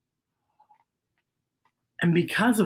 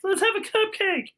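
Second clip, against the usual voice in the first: cheerful friends; 13 semitones higher than usual. Acted. Voicing friends sharing snacks.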